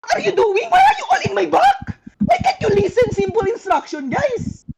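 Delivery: very high, disbelieving yell with a laugh; exasperated laughing shout. Real, not acted. Team clumped behind, exasperated shouting.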